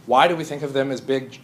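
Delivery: ordinary talk, nothing marked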